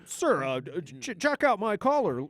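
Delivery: mock self-important major; vain major. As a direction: show off the collar like a proud peacock. Mocking vain major showing rank.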